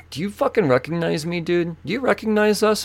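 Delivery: high-pitched, indignant challenge; retold confrontation. Retold confrontation with splashing driver.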